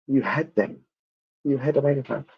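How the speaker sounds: normal talking voice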